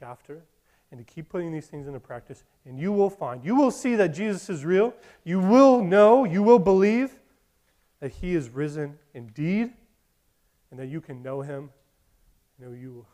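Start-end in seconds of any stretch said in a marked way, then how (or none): 3.4-9.7 loud, higher pulpit voice; impassioned sermon voice; preaching climax. Real, not acted. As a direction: drive it home to the church.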